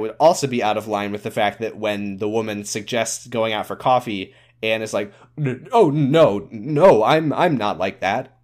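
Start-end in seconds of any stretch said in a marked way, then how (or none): 5.4-8.4 stammered, hurried-to-deny acted protest; mock awkward denial, slowing at the end; flustered refusal. Anne turning down coffee with the woman, mock flustered.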